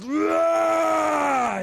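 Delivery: long loud high roar; monster roar. A narrator's reading. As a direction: stomp and roar. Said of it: "he roared".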